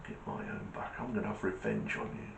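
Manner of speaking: very soft, low plotting; acted hushed menace; vengeful thought. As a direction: mutter revenge to yourself under your breath. Patient plotting revenge in thought.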